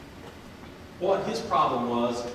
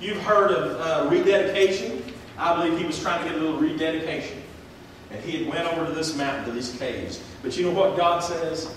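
normal talking voice

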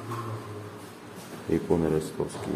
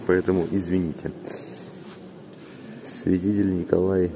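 ordinary talk, nothing marked

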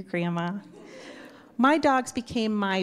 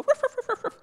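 imitated dog barks; faster than usual. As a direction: bark like an overjoyed little dog. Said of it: "race back and go".

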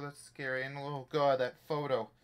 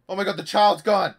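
loud, high shocked cry; real startled exclamation; alarmed shout; 7 semitones higher, 17 dB louder than usual. Real, not acted. Discovers baby missing.

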